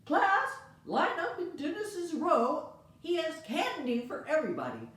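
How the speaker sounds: put-on teacher rallying the kids to line up; excited teacher. Teacher calls class to come get candy, excited and loud.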